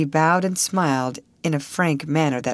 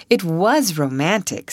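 ordinary delivery, nothing marked

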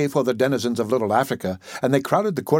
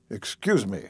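put-on lower, softer "Excuse me" from the young lawyer; apologetic attorney; 3 semitones lower, 5 dB quieter, slower than usual. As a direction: back down politely.

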